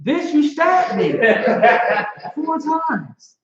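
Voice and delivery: high, slow, outraged squeal through laughter; a husband yelping in disbelief at his wife. Retold or imitated. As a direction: act the stabbed man, aghast. Husband confronting wife over stabbing.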